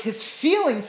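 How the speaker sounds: usual speaking voice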